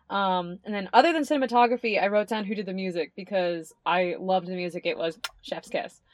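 normal talking voice

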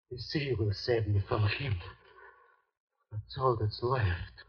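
low, soft, hollow resignation; quiet broken murmur; hushed despair. Acted. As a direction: say it to yourself, already beyond hope. Mate resigned before suicide.

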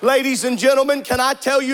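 ordinary talk, nothing marked

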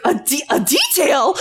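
high, laughing disbelief; imitating someone who can't believe what they just heard; mock incredulous. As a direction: echo it back in high-pitched, giggling outrage. Retells daughter's incredulous echo.

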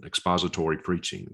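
usual speaking voice

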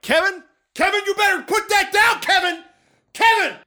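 high, loud shouting of the dog's name. Retold or imitated. Owner chasing his dog across the backyard, loud shouting. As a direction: bellow the name across the yard.

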